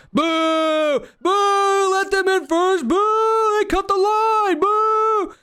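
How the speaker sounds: high, drawn-out heckling boos; intense crowd booing. Acted. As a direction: heckle like an angry queue. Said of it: "you hear intense booing from the line".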